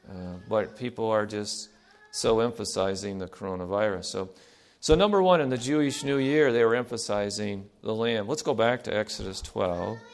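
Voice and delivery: ordinary talk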